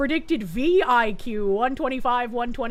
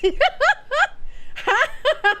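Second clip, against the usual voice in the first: high burst of laughter; 12 semitones higher than usual. Real, not acted. Laughing at the English pronunciation.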